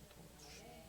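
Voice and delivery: very soft, almost inaudible repeat; hushed echo. Real, not acted. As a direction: let the words die away to yourself. Preacher echoes own answer. (혼잣말처럼 아주 작게 되뇌기)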